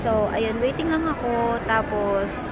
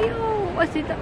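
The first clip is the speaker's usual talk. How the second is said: high-pitched mock wail; imitated crying; 9 semitones higher than usual. Vlogger imitating two friends crying at the airport, mock wailing. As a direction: imitate a tearful cry, pitched way up. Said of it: "na umiiyak".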